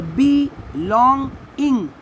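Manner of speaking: "बी लॉन्ग इंग" as three detached syllables; split into syllables. Shown as a demonstration. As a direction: separate each beat of "बी लॉन्ग इंग". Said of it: "ऐसे अलग करना है".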